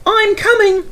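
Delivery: very high loud engine call; tooting call. A narrator's reading. Mavis calling to Toby, high and loud. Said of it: "she tooted".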